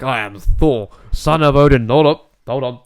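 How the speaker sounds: loud, high proclaiming god voice; booming Thor voice. Retold or imitated. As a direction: announce yourself like a god, then stop. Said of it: "he's there like".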